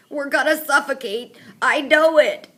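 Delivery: loud, high panicked wail; panicky announcement. A narrator's reading. Mouth breather in crate, loud panicked wail. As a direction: declare doom, rising loud. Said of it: "announces the mouth breather".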